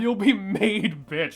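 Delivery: loud, slow, laughing repeat of You'll be made bitch; laughing echo. Real, not acted. Friend loudly repeating the burn while laughing. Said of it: "That's really good".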